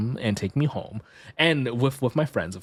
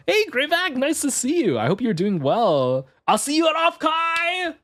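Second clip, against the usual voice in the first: excited greeting; 12 semitones higher than usual. Real, not acted. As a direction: greet a friend with delight.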